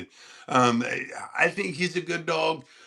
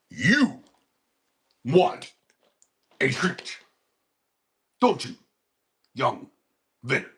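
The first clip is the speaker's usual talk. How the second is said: odd dog talk; slower than usual. Real, not acted. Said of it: "I don't know why I was talking like that".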